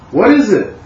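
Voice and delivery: loud, high, abrupt challenge; sharp master's demand. Retold or imitated. Zen master jolting a confused student, loud and high. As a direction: bark the question at the student. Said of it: "he may say".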